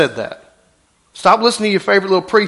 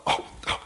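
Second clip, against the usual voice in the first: unvoiced little "Oh, oh" of tripping; whispered stumbling gasp; 13 dB quieter than usual. Preacher acting out a stumbler tripping, whispered gasp. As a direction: breathe out a startled stumble. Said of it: "Constantly what?".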